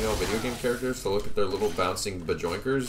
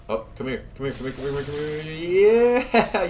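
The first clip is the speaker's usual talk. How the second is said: instinctive rage; 7 semitones higher, 3 dB louder than usual. Real, not acted. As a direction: wind up into a primal gamer roar. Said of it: "instinctive rage in your voice".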